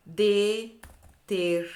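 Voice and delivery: "detergente" very slow and drawn out; stretched word. Shown as a demonstration. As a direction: drag out "detergente" syllable by syllable. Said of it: "pra gente comparar".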